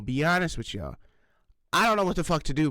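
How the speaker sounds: ordinary talk, nothing marked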